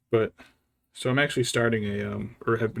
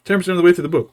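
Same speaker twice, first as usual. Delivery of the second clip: louder, higher, fed-up disbelief; exasperated outburst; 6 semitones higher, 11 dB louder, much faster than usual. Retold or imitated. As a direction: blurt it out, exasperated. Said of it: "And I'm like".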